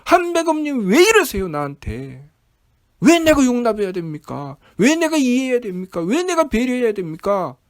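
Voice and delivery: high, loud mock complaint to God; resentful protest. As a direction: act out an aggrieved outburst, easing toward the end. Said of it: "라고 원망하죠".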